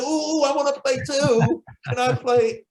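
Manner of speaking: high-pitched imitation of a child begging to join in; eager little kid. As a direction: play an excited kid wanting a turn. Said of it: "you remember when you was little".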